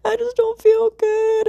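high, slow mock whimper; whiny crying baby. Telling mom about being sick, babyish mock whine. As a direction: whine it out like a babyish little girl. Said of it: "like a baby".

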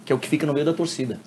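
usual speaking voice

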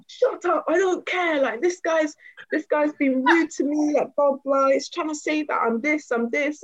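high-pitched, aggrieved rant; indignant retort. Retold or imitated. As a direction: imitate an angry man brushing someone off. Acting the angry man's retort.

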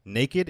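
put-on naked said slowly as NAY-kid; two-syllable pronunciation. Shown as a demonstration. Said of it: "is pronounced naked".

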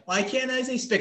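put-on high-pitched child's protest; imitated questioning kid. Kid challenging his counselor, imitated high questioning voice. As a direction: ask it like a kid, voice pitched right up. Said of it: "he's like".

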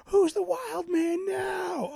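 high, slow, drawn-out mock shout; roommate's triumphant shout. Fan acting out the roommate's line, high shouting. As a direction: holler it out like the movie roommate. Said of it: "when Jon Favreau, his fat roommate, goes".

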